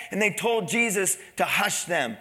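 normal talking voice

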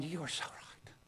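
breathless defeated whisper. Retold or imitated. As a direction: concede in a whisper. Defeated believer whispers agreement.

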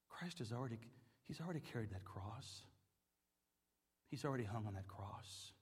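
a hush after the storm; hushed reverence. Acted.

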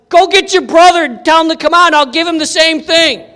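loud, high, triumphant dare; acted cocky yell; shouted challenge. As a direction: call out the next opponent. Victor challenging the other twin.

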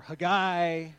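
Haggai as huh-GUY, slow and loud; alternative pronunciation. Shown as a demonstration. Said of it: "however you want to say it".

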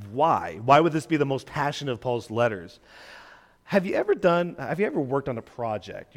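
normal talking voice

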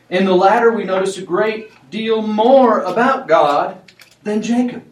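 loud and high, building up; rising preaching fervor. Acted.

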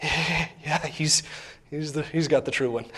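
low, soft, halting admission; stunned murmur. Retold or imitated. Onlookers after the fire falls, sheepish stunned murmur. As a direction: concede it quietly, with a small laugh.

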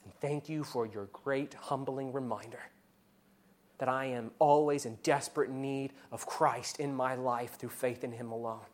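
quiet, restful acceptance of grace; humble reply. Acted. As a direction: answer the accuser gently and humbly. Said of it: "we can respond".

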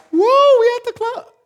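very high, loud whoop; party cheer. Retold or imitated. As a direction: celebrate at the club. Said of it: "the next day you're like".

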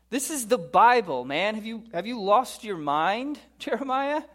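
high, swooping mock disbelief; comically appalled, rising to a question; incredulous outrage. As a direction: scold him as if he's gone mad.

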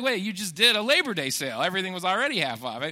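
usual speaking voice

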